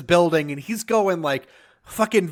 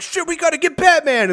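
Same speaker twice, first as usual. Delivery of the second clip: high-pitched mock alarm; urgent cop cry; 10 semitones higher, 3 dB louder than usual. Cops rallying to chase Batman, urgent and high. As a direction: call the chase in a hurry.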